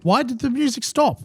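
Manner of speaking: high, baffled question; incredulous. Retold or imitated. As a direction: ask in disbelief. Retold confusion mid-fight.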